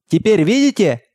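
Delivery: high, challenging bark; barked shout. A narrator's reading. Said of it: "гаркнул отец".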